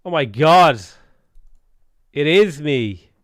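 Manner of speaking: loud, real astonishment; astonished exclamation. Real, not acted. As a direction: cry out in stunned recognition. Shocked to see his lookalike.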